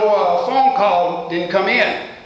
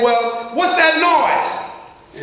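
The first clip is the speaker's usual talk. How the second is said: higher, drawn-out worried question; mild worry; 6 semitones higher, much slower than usual. A narrator's reading. Boss hears a noise down the phone, mildly worried. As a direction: ask slowly with pitch raised in concern. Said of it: "growing more and more worried".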